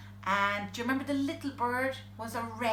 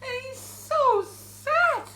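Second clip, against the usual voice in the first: high, loud, drawn-out woe; exaggerated pity; 10 semitones higher, 6 dB louder, slower than usual. A narrator's reading. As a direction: wail it slowly for the poor bear. Pitying the crying bear.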